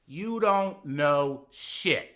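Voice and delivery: slow, loud gruff father voice; father's blunt put-down. Retold or imitated. Quoting his father's verdict, slow and loud. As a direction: bark it slowly like a stern dad. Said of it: "in the words of my father".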